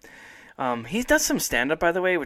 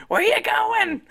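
put-on high, far screechy old lady cry; grandma screaming; 5 dB louder, slower than usual. Grandma yelling after her grandson from the house. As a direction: shriek it like a nagging grandma. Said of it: "she just screams".

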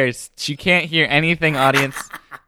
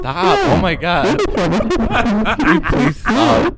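distant, muffled, pitched-up playback; muffled in the distance; 10 semitones higher, 3 dB louder, slower than usual. Shown as a demonstration. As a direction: sound far away and muffled. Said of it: "artist's interpretation of what it sounds like".

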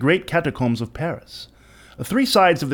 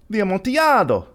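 astonished exclamation; 8 semitones higher than usual. A narrator's reading. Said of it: "ejaculated my friend".

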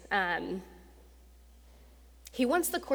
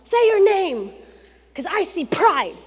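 much higher, loud insistence; forceful coach; 10 semitones higher, 9 dB louder than usual. Retold or imitated. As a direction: drive the words home like a bossy coach. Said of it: "And he's like".

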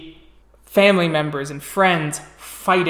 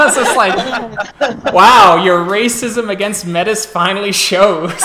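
laughing teasing; 4 semitones higher, 7 dB louder than usual. Real, not acted.

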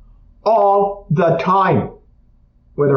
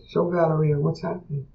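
softer, hushed check-in; gentle quiet question; 9 dB quieter than usual. Retold or imitated. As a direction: ask softly, almost under the breath. Gentle question after session.

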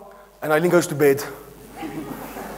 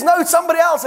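firm refusal; 9 semitones higher, faster than usual. Retold or imitated. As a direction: refuse once and for all. Wife's refusal.

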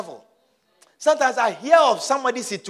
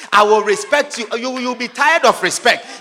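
loud, defiant vow; acted forceful insistence; angry resolve; 4 semitones higher, 12 dB louder than usual. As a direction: hurl the promise back at him. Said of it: "Be angry".

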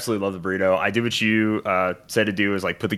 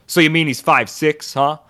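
higher mock tough guy quip; Sopranos-type guy; 6 semitones higher, 5 dB louder than usual. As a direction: toss it off like the Sopranos neighbour. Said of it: "the guy just goes".

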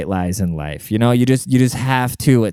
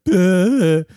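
mock ugly cry; 8 semitones higher, 4 dB louder than usual. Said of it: "it wasn't like a, like".

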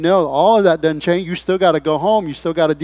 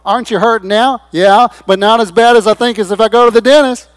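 raised, louder back-and-forth voice; acted anxious argument; 5 semitones higher, 8 dB louder than usual. Reluctant dental patient is challenged and protests, raised voice. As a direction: argue it out loudly and higher, like a reluctant patient.

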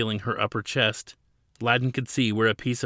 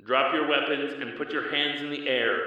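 higher amplified loudspeaker order; projected command; 4 semitones higher than usual. A narrator's reading. Police hovercraft ordering surrender, amplified loudspeaker command. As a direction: call it down through a loudspeaker. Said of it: "the voice commanded".

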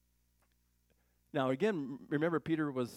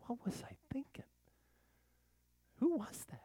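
high, whispery self-questioning; hushed regret; 12 semitones higher, 4 dB quieter than usual. Acted. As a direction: act out dawning regret under your breath. Voices regret after doing wrong.

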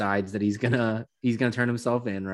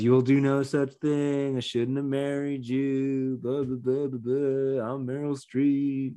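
slow, drawn-out, higher theatrical wife; hammed-up scolding, stretched out evenly; mock-dramatic movie-star delivery; Meryl Streep; 4 semitones higher, slower than usual. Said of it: "didn't use the proper tone".

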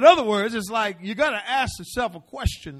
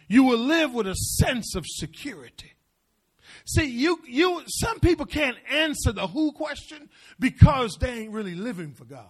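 ordinary talk

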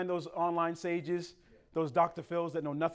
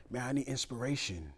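stoned friend; 3 semitones lower, 4 dB quieter than usual. Retold or imitated. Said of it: "why are you talking like that".

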